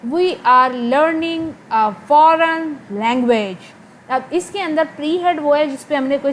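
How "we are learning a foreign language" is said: very slow, with LEARNING and FOREIGN leaning on first syllables; stress on stressed syllables. Shown as a demonstration. Teacher demonstrates sentence intonation to students, slow stress on "learning", "foreign", "language". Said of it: "मैं आपको बोल के दिखाती हूँ".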